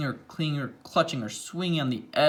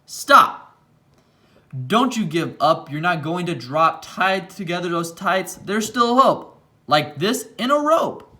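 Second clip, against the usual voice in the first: urgent shout; 4 semitones higher, 5 dB louder than usual. Acted. Bo shouting encouragement in story.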